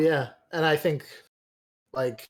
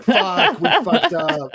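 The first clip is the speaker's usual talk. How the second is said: high, loud laughing yelp of panic; panicked outburst; 7 semitones higher, 8 dB louder, slower than usual. Retold or imitated. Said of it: "And it's like".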